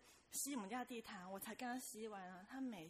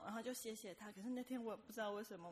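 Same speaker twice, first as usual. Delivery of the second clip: ordinary talk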